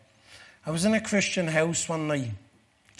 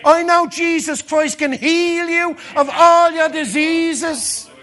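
climactic preaching; 10 semitones higher, 10 dB louder than usual. Acted. Preacher at the peak of his sermon, higher and louder. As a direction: shout the promise to the rafters.

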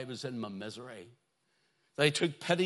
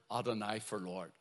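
usual speaking voice

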